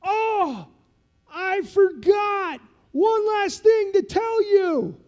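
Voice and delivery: slow, high, loud teasing shout; mock sergeant. Sergeant teasing lieutenant before pushing him, loud and slow. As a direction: savor the last-second news. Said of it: "And he goes".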